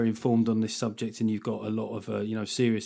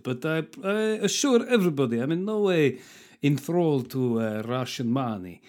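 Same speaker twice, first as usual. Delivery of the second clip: put-on terrible Russian accent. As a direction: lay on a bad Russian accent. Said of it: "I just thought I'd start off with a terrible Russian accent there".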